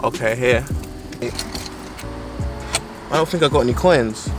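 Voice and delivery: normal talking voice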